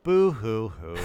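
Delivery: mock sobbing boo hoo; fake crying. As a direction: pretend to weep mockingly. Mocking complaint.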